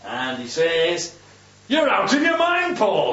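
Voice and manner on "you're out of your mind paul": high-pitched exclamation at an opponent; acted shout. Festus interrupting Paul's defense, mock shouting. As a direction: cry it out as an outraged interruption. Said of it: "he shouted".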